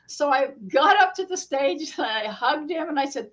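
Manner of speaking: ordinary talk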